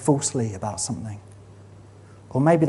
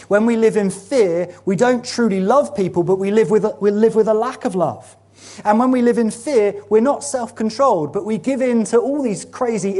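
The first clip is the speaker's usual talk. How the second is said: fervent preaching; 6 semitones higher than usual. Real, not acted. Pastor urging the congregation, fervent rising preaching. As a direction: drive the point home to the church.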